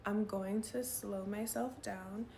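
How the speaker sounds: slow, deliberate self-talk. Retold or imitated. Telling herself to slow down, said slowly. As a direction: stretch each word out on purpose. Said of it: "I was able to be like".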